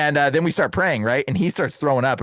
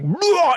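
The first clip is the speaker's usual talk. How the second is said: high, sudden mock retch; imitated retching. Man over the trash can, mock vomiting. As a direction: heave it out like throwing up. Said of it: "throwing up".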